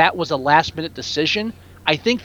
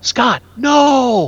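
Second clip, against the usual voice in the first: mock outcry; 6 semitones higher, 4 dB louder than usual. Mock-scolding a film character.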